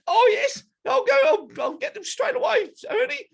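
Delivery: squeaky-high falsetto gorilla; obey eagerly in a very high put-on voice; eager gorilla sidekick. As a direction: falsetto henchman, keen to please. Second gorilla eagerly obeys.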